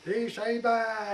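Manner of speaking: slow, loud, high call down to the boat. Retold or imitated. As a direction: stretch it out and call down over the side. Calling out to vendor below.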